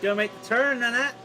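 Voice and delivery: put-on jokey Pittsburgh accent; mock Pittsburghese. Imitating Pittsburghese accent in banter.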